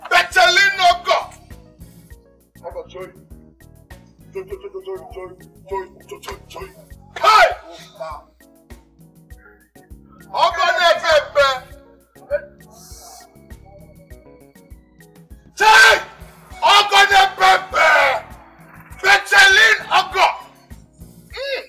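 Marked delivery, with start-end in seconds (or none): 0.1-1.3 loud, slow holler; exuberant shouting. Acted. Calling out the name. 7.2-20.3 very loud, raised-pitch hollering; exuberant shouting. Acted. Excited shouting of name and praise.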